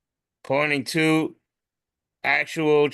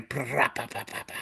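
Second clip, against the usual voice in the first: rolled rattle of playing cards in spokes; rapid sputtering "pra-pa-pa", trailing softer; imitated spoke rattle; 8 dB quieter than usual. Shown as a demonstration. Showing friends how bike cards sounded, imitated rattle.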